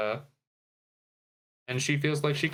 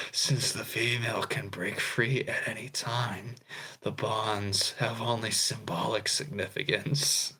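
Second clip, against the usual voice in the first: Discord mod voice; 2 semitones lower than usual. Acted. Host reading Peter's spider courtship lines, whispered Discord mod voice. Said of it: "I'm gonna do this in my Discord mod voice".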